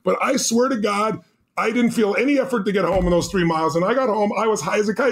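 ordinary talk

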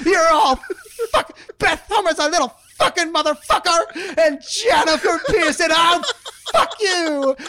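unload on them, cackling between insults; mock-furious yelling through laughter; fired-up rant. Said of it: "I've never seen him so fired up".

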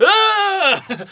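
high mock cry of disgust; appalled gasp. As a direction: imitate a star recoiling in horror. Imitates the star's appalled reaction.

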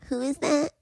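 high, airy mock-innocent voice; mock airhead. Friend mocks Zara pretending not to know, high airy voice. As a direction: play the fake-clueless girl. Mimicking a clueless girl.

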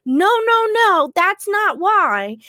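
high, loud, drawn-out no; emphatic denial. Retold or imitated. Storyteller overturning her own wrong guess, emphatic denial. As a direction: shoot the idea down loudly and slowly.

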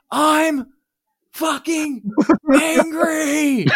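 high, loud furious cry; imitating a raging bassist; mock furious yell. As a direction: scream it like a man losing it. Bandmate screams into microphone.

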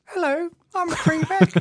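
very high, squeaky cartoon egg; cream egg voice. Host auditioning for an Easter advert, high cartoon voice. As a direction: pipe up loudly as a silly little animated egg. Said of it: "What voice would I do for a cream egg".

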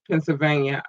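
ordinary talk, nothing marked

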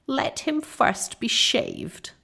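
high, slow commanding witch; slow, high-pitched witch giving an order; witch's cold order. A narrator's reading. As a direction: order it like a cruel queen. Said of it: "said the witch".

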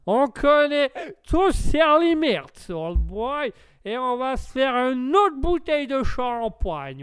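put-on high, loud American-accented French. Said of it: "un Américain connaît-il ses limites".

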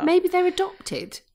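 high, slow sing-song suggestion; mock suggestion. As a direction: float the idea in a silly voice. Suggests with put-on voice.

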